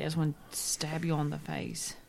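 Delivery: soft whispered mock threat. Real, not acted. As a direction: whisper it through exasperation. Hushed threat.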